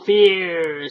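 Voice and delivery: very slow, monotonous menace; slow, harsh golem voice; golem's threat. Acted. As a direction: drag it out darkly. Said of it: "said the stranger".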